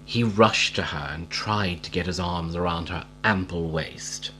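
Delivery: ordinary delivery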